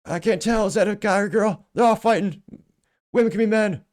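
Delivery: hammed-up doddering announcer shout; Joe Biden impression. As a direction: yell it like a confused old politician at a fight. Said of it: "he'd probably say".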